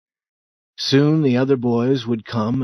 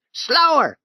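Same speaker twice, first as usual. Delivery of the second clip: high, louder captain's orders; shouted commands; 4 dB louder, slower than usual. Acted. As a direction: bark the orders down the river. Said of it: "he shouted".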